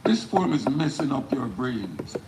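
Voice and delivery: emphatic spoken-word delivery, phrases falling at the ends; poem recitation. Acted. Poet performing the poem live for an audience, declaimed. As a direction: declaim each line with weight, pausing between phrases.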